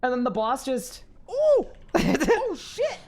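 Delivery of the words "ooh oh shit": high, slow mock gnome squeal that melts into a laugh; imitating gnome squeal. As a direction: redo that silly high gnome noise. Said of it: "I sounded like a gnome".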